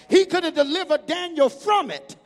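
loud, rising sermon shout to the congregation; preaching at full force. Acted. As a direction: drive it home like a preacher whipping up the church.

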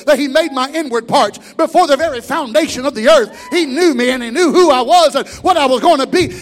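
loud, building sermon cry; preaching at full force. Acted. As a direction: lift the church with it.

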